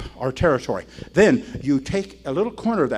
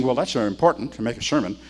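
usual speaking voice

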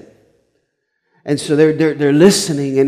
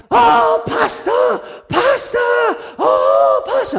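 very high shrieked calls; frantic lady; much slower than usual. Retold or imitated. Lady escaping the stinking bus calling the pastor, high frantic cries. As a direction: shriek his title in distress.

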